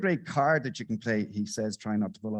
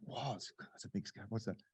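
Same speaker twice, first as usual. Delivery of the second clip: much softer, awed hush over a scar; hushed awe; 4 semitones lower, 16 dB quieter than usual. Retold or imitated. Lads in a bar marvelling at a scar, hushed. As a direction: gasp at it under your breath.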